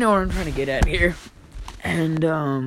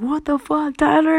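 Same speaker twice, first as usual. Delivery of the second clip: high, loud incredulous shout; shouting at a friend in disbelief, high and loud; shocked outburst; 11 semitones higher, 4 dB louder than usual. Retold or imitated. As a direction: yell in disbelief at the scene. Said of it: "And I was like".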